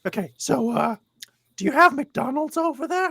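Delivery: high-pitched, curious put-on voice; eager fan impression. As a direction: ask eagerly in a squeaky-high mimicking voice. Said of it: "this is literally how he's, uh, how he's speaking".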